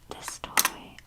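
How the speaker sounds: normal talking voice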